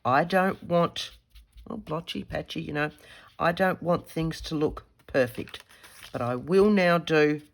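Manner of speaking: ordinary delivery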